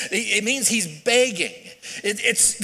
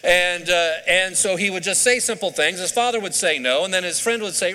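normal talking voice

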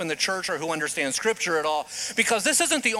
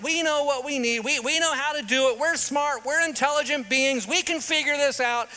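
boastful mankind; 7 semitones higher than usual. Retold or imitated. Preacher voicing boastful mankind.

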